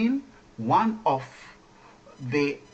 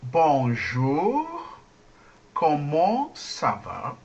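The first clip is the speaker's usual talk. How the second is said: slow, spaced-out French for repeating; slow demonstration; much slower than usual. Shown as a demonstration. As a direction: say it slowly, word by word. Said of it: "I have to go slowly".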